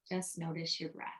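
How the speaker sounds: soft, unhurried meditation voice with long pauses; guided meditation. Real, not acted. Meditation guide leading listeners to notice their breath, soft guided meditation. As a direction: quietly guide them through each breath, letting silences sit.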